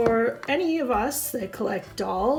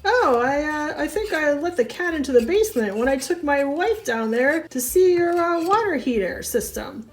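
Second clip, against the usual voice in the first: lightly voiced brother-in-law; 5 semitones higher than usual. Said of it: "it went more like this".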